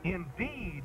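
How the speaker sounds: ordinary talk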